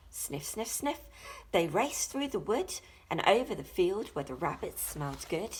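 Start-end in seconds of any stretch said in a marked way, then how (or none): 0.1-1.0 quick soft sniffs, rising; sniffing sounds. Acted. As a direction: sniff like a dog on a scent. Dog sniffing.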